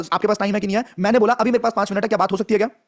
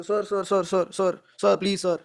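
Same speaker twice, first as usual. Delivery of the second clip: softer pleading, hands folded; begging sir sir; 5 dB quieter, much slower than usual. Retold or imitated. Salesman pleading with a prospect, softer and begging. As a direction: grovel softly to the prospect. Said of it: "हाथ जोड़ लिया बिल्कुल ऐसे".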